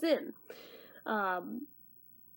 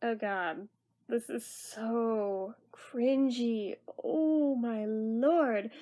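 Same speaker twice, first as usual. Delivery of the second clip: slow, drawn-out dismay; cringing; much slower than usual. Real, not acted. Reviewer appalled by a childhood favourite, cringing slowly. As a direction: stretch the words out in embarrassment. Cringing at film watched as adult.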